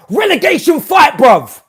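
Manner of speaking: half laughing, half raging shout; exasperated shout. Real, not acted. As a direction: yell it at the screen in angry laughter. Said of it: "at this point of, like, anger and laughter".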